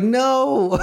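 high, squeaky cry of disbelief; a stretched, high-pitched, horrified no; dismayed no. Retold or imitated. Dad dismayed at son's gaffe.

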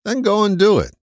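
high, firm call to act; urging push. Real, not acted. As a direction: give them a nudge. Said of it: "urging the listener".

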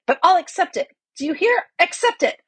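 high, heated declaration; defiant bawling. A narrator's reading. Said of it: "bawling out his acceptance".

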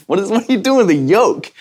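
mock disbelief, laughing, rising high at the end; incredulous. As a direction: scoff at the offer of a yoke. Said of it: "You know, like".

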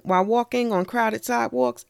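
ordinary talk

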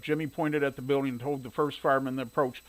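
ordinary delivery

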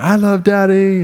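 put-on high, loud little-boy shout; imitating gleeful toddler. Toddler pointing back at daddy in a game, gleeful. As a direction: mimic a small son gleefully shouting back. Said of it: "he'll point back at me and be like".

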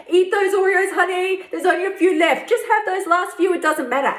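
high coaxing wheedle; conniving sweet voice. Retold or imitated. Urging listener toward last Oreos, high conniving coaxing voice. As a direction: coax like a sly tempter. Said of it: "voice that will connive its way around".

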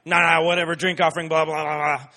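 loud, careless brush-off; mocking a bored Bible reader; dismissive brush-off. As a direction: wave it all away loudly. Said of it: "just be like".

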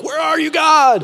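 high, loud, drawn-out wail; anguished cry. Acted. Dumped teenager calls to God, mock anguished cry. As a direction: wail it up at heaven, hammed-up.

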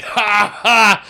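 loud, high forced "ha, ha"; mock laugh. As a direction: bark out two big fake laughs. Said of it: "I just started laughing".

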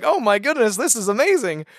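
a light replay of being overwhelmed; amazed inner voice. Retold or imitated.

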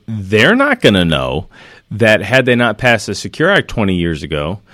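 ordinary talk, nothing marked

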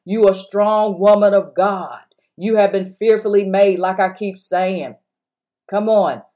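usual speaking voice

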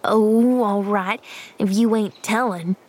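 put-on grudging, sulky boy; grunted. A narrator's reading. Boy gives up asking Pollyanna, grudging grunt. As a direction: give up with a mild grunt. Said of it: "grunted the boy".

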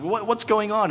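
high, flustered mock confusion; imitating a preacher caught by the alarm; startled preacher. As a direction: stammer it in mock bewilderment. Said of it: "And that person".

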